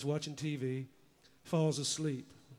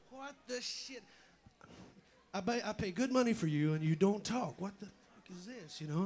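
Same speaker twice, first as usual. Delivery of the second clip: frustrated guy; 4 semitones higher than usual. Acted. Angry owner yells at silent parrot.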